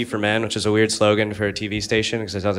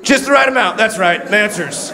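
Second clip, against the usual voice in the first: monster truck ad; 11 semitones higher, 4 dB louder than usual. Retold or imitated. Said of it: "that guy who does monster truck ads".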